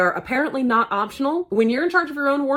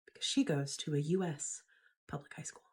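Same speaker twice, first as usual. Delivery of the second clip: whispering; 7 semitones lower, 13 dB quieter, faster than usual. Real, not acted. Sombre aside after shield talk.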